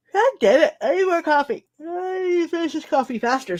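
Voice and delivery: high voice stretched by a yawn; real yawning. Real, not acted. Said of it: "so I stop yawning".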